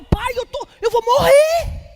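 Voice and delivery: high, loud cry of despair; mock-cry to God like you're dying; desperate prayer. Believer's desperate prayer acted.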